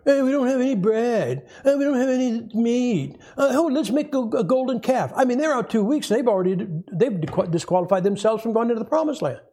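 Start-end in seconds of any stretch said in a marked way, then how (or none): 0.1-5.1 griping Israelites. Retold or imitated.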